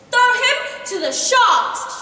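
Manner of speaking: slow, high, loud command; teacher ordering a pupil to the sharks. A narrator's reading. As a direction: order it slowly and loudly. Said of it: "she commanded".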